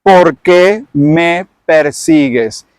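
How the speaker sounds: very slow, solemn heavenly question; the risen Lord questions Saulo, slow and solemn. Acted. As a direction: draw it out like a voice from the sky. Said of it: "esta es la voz que sale".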